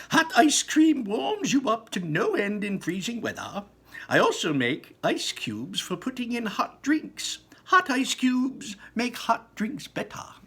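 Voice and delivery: high and lively sales talk; rushing Wonka. A narrator's reading. Wonka praising his hot ice cream, high and lively. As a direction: pitch the product on the move. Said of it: "said Mister Wonka".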